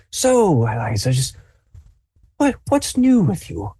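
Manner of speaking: put-on an old man at the end of his patience; exasperated grandfather. Grandfather demanding his tea after the noise dies down, high and exasperated. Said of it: "he's like".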